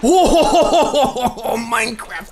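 loud, high whoop of delight; excited cheer. Real, not acted. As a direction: burst out with a big, high oh. Gamer cheers a speedrun trick.

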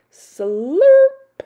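high, loud rising slurp word; exaggerated slurp. A narrator's reading. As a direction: swoop up loudly like slurping.

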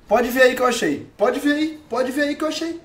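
high, eager insistence; excited insisting. Real, not acted. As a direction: insist excitedly that it's true. Insists he really found it.

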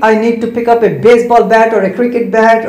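normal talking voice

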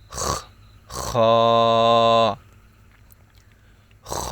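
খ with a scraping rasp from the throat back; rasping kha. Shown as a demonstration. Teacher showing a harsh throat letter. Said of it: "একটু খরখর".

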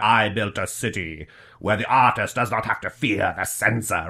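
quiet, grand villain monologue; lightly voiced game villain, hushed; BioShock villain voice. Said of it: "the villain in the first BioShock video game".

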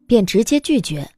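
ordinary talk

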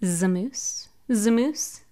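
Zamoose blended slowly as za-moose; sounding it out. Shown as a demonstration. Teacher sounds out the title for children. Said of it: "Why don't we sound it out?".

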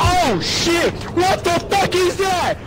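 loud startled yelling; panicked shouting. Real, not acted. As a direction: scream it in panic. Startled by enemy in game.

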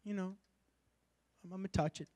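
soft, limp mock-casual touch; barely breathed, dismissive; a half-hearted pretend touch. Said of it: "He didn't touch it like".

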